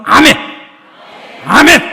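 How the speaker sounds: building, very loud amen call; shouted amen. Real, not acted. A pastor loudly calling the congregation to answer amen. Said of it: "calling for amen".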